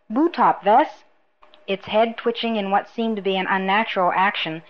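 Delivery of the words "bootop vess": understated bellow, pitched up; bullying miner's raised-voice order. A narrator's reading. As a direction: call out a command to the creature.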